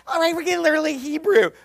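quick, high mock kiddie-teacher voice; mock him cooing a lesson at toddlers; Jim Shetler. Preacher mocking a colleague's Hebrew lesson, quick high exaggerated mimicry. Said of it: "He's like".

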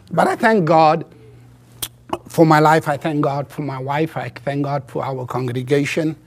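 normal talking voice

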